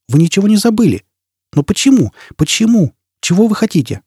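high-pitched, bewildered demand; acted agitated questioning; incredulous questioning. A narrator's reading. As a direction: press for answers in disbelief. Woman confronts the man faking amnesia.

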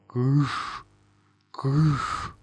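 eerie drawn-out ghostly calls; spooky ghost noises. Acted. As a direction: moan like a ghost to scare them. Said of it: "изощрялся я".

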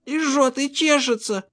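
put-on high child voice, complaining; маленькая дочь. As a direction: whine to daddy in a little girl's high voice. Дочь жалуется папе на зуд. (жалобный высокий детский голосок)

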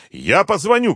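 high, quick parting cry; narrated yell, pitched well up; calling out. A narrator's reading. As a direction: call back while being pulled away. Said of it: "крикнула она, исчезая за дверью".

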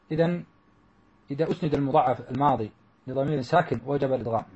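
ordinary talk

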